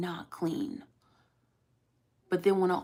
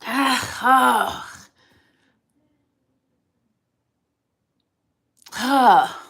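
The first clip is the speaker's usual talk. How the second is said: frustrated groans; 7 semitones higher, 10 dB louder, much slower than usual. Real, not acted. Held back from telling news.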